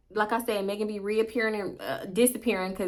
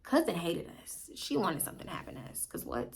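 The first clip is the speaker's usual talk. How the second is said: quiet aside; 3 semitones lower, 12 dB quieter than usual. Real, not acted. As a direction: drop to a conspiratorial aside.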